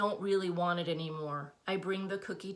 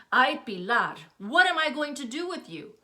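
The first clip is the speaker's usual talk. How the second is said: exasperated mother; 7 semitones higher, 8 dB louder than usual. A narrator's reading. Mother scolding daughter over cookies, exasperated. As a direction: throw up your hands at her daughter. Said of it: "Her hands fly up in frustration".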